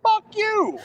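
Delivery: high, loud joking "Fuck you"; squeaky high mock curse; mock rude retort. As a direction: fire it back as a playful insult. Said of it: "And I was like".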